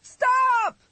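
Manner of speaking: high, loud panicked shriek. A narrator's reading. Frightened driver shrieking at the reckless pilot. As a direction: shriek it in terror. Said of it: "shrieked the driver".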